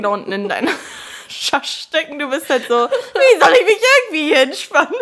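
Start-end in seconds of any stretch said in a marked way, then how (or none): 2.7-4.9 mock despair. Retelling gynecologist visit.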